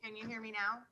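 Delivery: faint voice far from the mic; very faint, hard to hear; barely audible. Real, not acted. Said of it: "No, it's still very faint".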